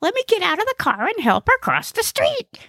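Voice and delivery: very high, eager comic voice; high-pitched eager little helper; eager Gnesi. Acted. Gnesi wants to help an old lady cross, high and eager.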